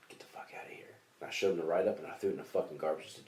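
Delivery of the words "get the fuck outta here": hushed retold warning; urgent whispered get lost. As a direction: whisper it so nobody hears. Retold whisper to bully kid.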